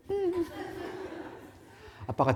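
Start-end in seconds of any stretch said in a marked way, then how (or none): 0.1-0.5 put-on very high, childlike answer; small child's yes. Preacher voicing the little child answering his father, very high. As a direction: answer like a shy little kid.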